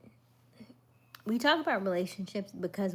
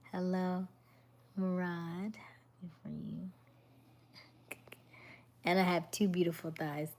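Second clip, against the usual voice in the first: ordinary delivery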